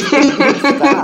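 high, loud mock yell; loud comic protest; retold stop order. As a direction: burst out as an exasperated parent. Father cuts off mocking child.

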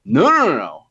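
high, loud string of nos; urgent objection. Retold or imitated. Customer rejecting the cheap town certificate, urgent objection. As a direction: cut in fast to stop them. Cuts off a clerk's offer, retold.

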